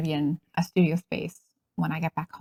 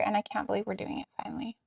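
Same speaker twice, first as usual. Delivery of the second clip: excited whisper; 9 dB quieter, faster than usual. Real, not acted. Excited about finally doing show.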